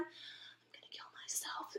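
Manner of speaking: very soft, slow whispered aside; mortified whisper. Retold or imitated. Embarrassed friend during the street singing, despairing whisper.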